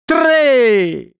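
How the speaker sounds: high-pitched drawn-out shout; high excited yell; shrieked count. A narrator's reading. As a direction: shriek it as the signal to go. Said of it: "strillò Mowgli".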